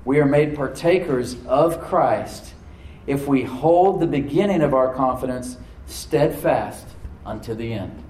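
slow, weighty scripture reading; read slowly. Shown as a demonstration. Pastor reading King James verse to church, slowly. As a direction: let each word of the promise land slowly. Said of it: "I'm gonna read it slowly now".

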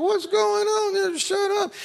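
very high, slow mock falsetto; comic husband in high falsetto as wife hauls him off. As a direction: squeeze it up high and drag it out, comically helpless. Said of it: "I'm like".

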